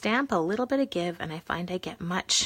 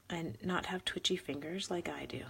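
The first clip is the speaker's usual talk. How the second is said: whisper; 11 dB quieter than usual. Real, not acted. Whispered self-mocking aside.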